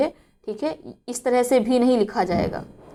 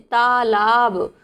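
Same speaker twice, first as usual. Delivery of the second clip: slow spelled-out word; much slower than usual. Shown as a demonstration. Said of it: "बल्कि कैसे लिखते हैं".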